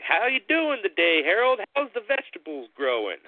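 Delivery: high, bright mock-friendly greeting; sing-song, teasing the scarecrow up high; playful greeting. Brothers teasing the scarecrow, high mock-cheerful greeting. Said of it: "one might say something like".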